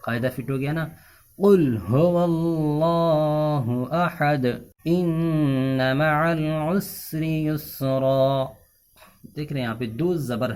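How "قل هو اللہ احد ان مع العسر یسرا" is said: very slow, melodic tajweed chant; Quranic recitation. Acted. Teacher recites the lesson verses, slowly chanted. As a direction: stretch every vowel in recitation.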